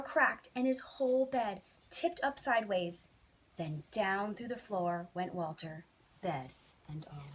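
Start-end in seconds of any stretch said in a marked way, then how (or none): none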